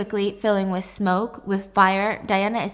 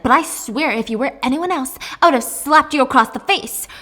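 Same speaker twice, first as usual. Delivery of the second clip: louder, higher threatening mother; angry threat; 5 semitones higher, 6 dB louder than usual. Acted. Mom gets in her daughter's face, angry threat. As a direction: threaten through building anger.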